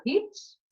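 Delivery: "ritmo" split, pause between rit and mo; ritmo in separate syllables. Shown as a demonstration. Teacher confirming separate syllables, "ritmo" split in two. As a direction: break "ritmo" after rit. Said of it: "Sílabas separadas".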